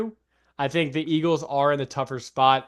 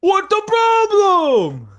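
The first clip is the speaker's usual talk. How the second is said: loud, high, drawn-out shout; mock fan holler; 8 dB louder, much slower than usual. Co-host teased about his Philly accent, high loud mock holler. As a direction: stretch it out in a high hammed-up yell.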